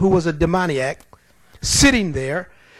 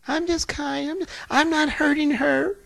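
higher, soft, sugary demon voice; nice and demure; 9 semitones higher, 4 dB quieter than usual. Retold or imitated. Demon pleading to stay, higher and sweetly demure. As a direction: purr it sweetly like a harmless thing. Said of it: "called himself being nice and demure".

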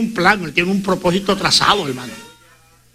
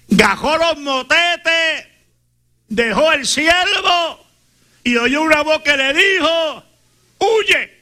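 higher, slow storytelling push; sermon climax on the flight, higher and drawn out; building preaching; 6 semitones higher, much slower than usual. Acted. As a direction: climb in pitch as the story rises to "Huye".

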